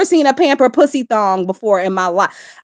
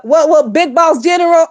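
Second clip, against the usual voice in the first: ordinary delivery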